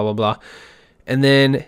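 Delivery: ordinary talk, nothing marked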